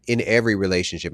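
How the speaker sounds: ordinary delivery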